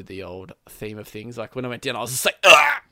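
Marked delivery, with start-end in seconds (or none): 2.4-2.8 short loud yell, much higher; mock pained scream. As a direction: yelp as if you've just been hit. Retold cry when hit in game.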